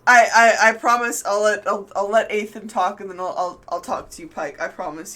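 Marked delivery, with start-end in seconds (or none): none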